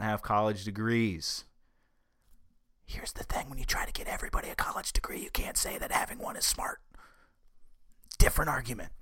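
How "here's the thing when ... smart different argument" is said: quick hushed whisper; whispering an aside. Real, not acted. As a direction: slip in a fast, confidential whisper. Confidential aside during article reading.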